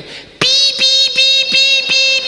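very high, loud piercing beeps; imitated car beeps. The car warns the boss, a piercing electronic beep. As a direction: beep like a car warning chime.

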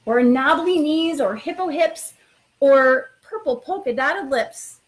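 expressive storytime read-aloud; reading to children. Acted. Principal reading a picture book to new students, lively storytime voice. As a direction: read it like bedtime story time for little kids.

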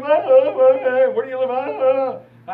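put-on choked, halting child sobs; sobbing stammer. Lost boy sobs to the store manager, stammering. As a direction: stammer through tears, unable to answer. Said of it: "I'm just bawling my eyes out".

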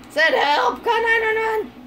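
high, loud wail for help; playful distressed shout; mock cry for help. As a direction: call out in hammed-up panic. Mock calling for help while laughing.

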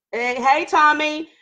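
ordinary delivery

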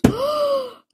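sharp, high-pitched gasp; shocked gasp. Real, not acted. Player suddenly facing two pizzas at once, shocked gasp. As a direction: suck in a loud, startled breath.

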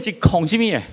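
ordinary talk

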